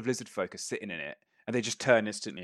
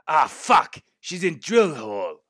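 high, loud lizard curse; raised, annoyed lizardfolk voice; exasperated lizardfolk character; 7 semitones higher, 9 dB louder than usual. Acted. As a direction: groan the news as a fed-up creature. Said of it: "He goes".

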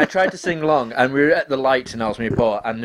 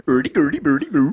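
mock Swedish nonsense babble; singsong cod-Swedish gibberish; Swedish Chef. Shown as a demonstration. Asked for Swedish, gives comic Swedish gibberish. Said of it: "where does the Swedish chef come from".

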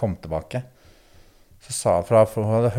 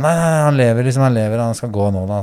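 higher and louder, rushed insistence; urgent reassurance; 4 semitones higher, 8 dB louder than usual. Retold or imitated. Daughter telling panicked mother he lives, urgent loud reassurance. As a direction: insist he is alive, loudly.

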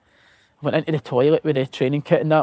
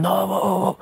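mock alarmed call; 5 semitones higher than usual. Boys in the queue warning him off, mock alarmed call. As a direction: imitate teammates calling out in alarm.

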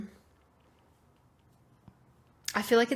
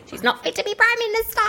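shrill high mock outrage; pompous critic; 4 dB louder than usual. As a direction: bellow like a scandalised pundit. Parodying critics.

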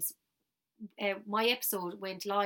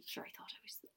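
whisper; 17 dB quieter than usual. Real, not acted. Self-mocking about her teenage cool.

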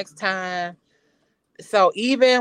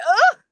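strained groan; 13 semitones higher, 8 dB louder than usual. Retold or imitated. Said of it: "you like".

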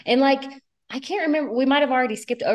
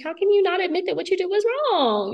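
whiny mock Stassi; 12 semitones higher, faster than usual. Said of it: "she's just like".

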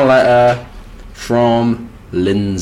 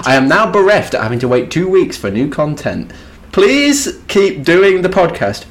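higher, lighter voice reading the letter; woman's voice; 7 semitones higher, 4 dB louder than usual. Retold or imitated. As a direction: read it as the woman who wrote it. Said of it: "What is your woman voice you're doing?".

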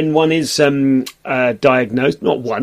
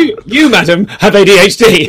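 loud, raised pronouncement; mock accusation; 5 semitones higher, 7 dB louder than usual. Guest telling his friend she has ADHD, mock verdict. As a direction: declare it like a verdict. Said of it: "I felt like going".

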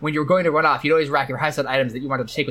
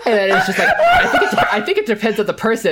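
high and louder, laughing through the words; 5 dB louder than usual. Real, not acted. Host cracking up at the car names, laughing. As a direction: giggle through the line.

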